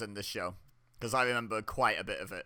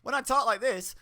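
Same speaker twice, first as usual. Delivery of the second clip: higher, lifted untrustworthy tone; insincere high voice; 10 semitones higher, 4 dB louder than usual. Shown as a demonstration. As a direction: sound like you can't be trusted. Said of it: "you can't trust a damn thing I'm saying".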